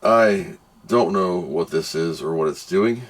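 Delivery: ordinary talk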